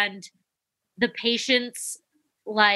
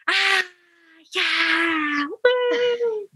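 distant crowd cheer; 9 semitones higher, much slower than usual. Retold or imitated. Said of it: "crowds in the distance going".

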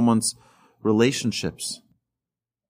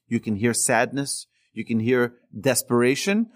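ordinary talk

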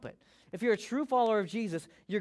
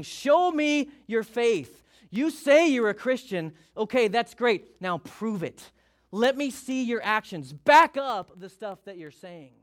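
louder and higher, demanding proof; forceful challenge; 3 semitones higher, 6 dB louder, slower than usual. Acted. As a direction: call them out, daring them to prove it. James challenging the listener loudly.

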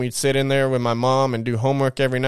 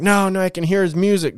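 high-pitched imitation of a panicking sister; retold freak-out; 8 semitones higher than usual. Sister insisting to her brother she hears music, retold panic. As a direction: lightly act out her frantic insistence. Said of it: "freaking out".

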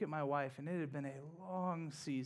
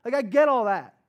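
louder and higher, fired-up preaching; impassioned; 7 semitones higher, 13 dB louder than usual. Real, not acted. Pastor confesses anger at God to congregation, louder and higher. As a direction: pour out the frustration, raised and hot. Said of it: "I'm mad".

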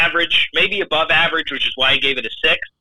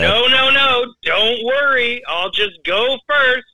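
mock martyr; 9 semitones higher, much slower than usual. Mock-sacrificing after "Thanks, Dad.

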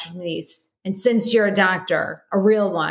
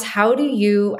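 ordinary talk

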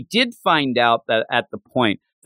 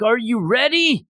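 challenger's call; 8 semitones higher than usual. Retold or imitated. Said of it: "they're like".

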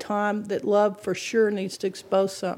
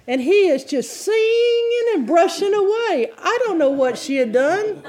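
higher and louder, laughing through it; laughing retelling; 8 semitones higher, 4 dB louder than usual. Retold or imitated. Pastor telling congregation about dad's antics, laughing. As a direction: tell it while cracking up.

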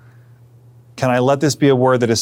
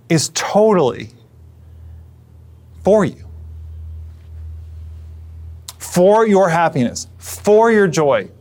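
hopeful Jesus; 4 semitones higher than usual. Retold or imitated. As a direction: coax them to imagine God is for them. Preacher voices Jesus speaking against doubt.

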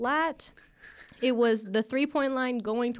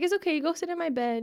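lightly voiced soothing mom, pitched higher; higher, gentle-parent impression; comforting mom; 7 semitones higher than usual. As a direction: imitate a mother reassuring her hurt child. Mom comforting, retold by daughter.

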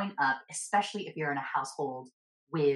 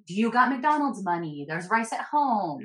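higher, slow, dismissive parent voice; mock frugal parent; 5 semitones higher, 4 dB louder, slower than usual. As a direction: drawl it out like a mom saying no. Voicing parent refusing fast food.

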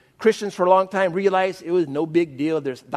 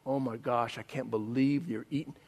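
shocked whisper; 6 semitones lower, 11 dB quieter than usual. Retold or imitated.

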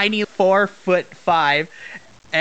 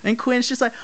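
ordinary delivery

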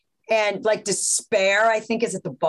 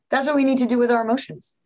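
ordinary talk, nothing marked

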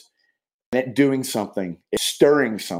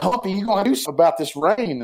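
higher, quicker mock of a fed-up dad ribbing Humpy; dad poking fun at Humpy about the rain, higher and faster; teasing dad; 8 semitones higher, faster than usual.

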